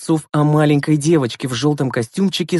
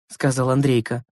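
ordinary delivery

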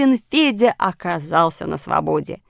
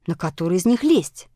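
usual speaking voice

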